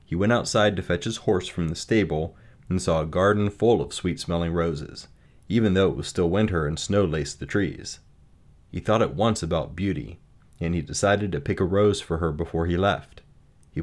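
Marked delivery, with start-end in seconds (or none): none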